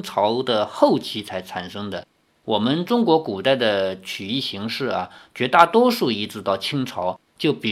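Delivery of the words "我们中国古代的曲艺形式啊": plain reading voice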